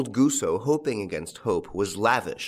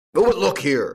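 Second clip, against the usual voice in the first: louder grumbling protest; growled; 6 semitones higher, 8 dB louder than usual. A narrator's reading. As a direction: growl it out, annoyed. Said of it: "growled Farmer Gusso".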